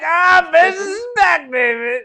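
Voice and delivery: high, loud mock delight; mock glee. Roadside memorial fan passing a crash, mock gleeful. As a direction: ham up ghoulish excitement.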